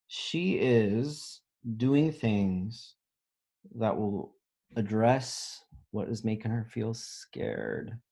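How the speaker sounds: usual speaking voice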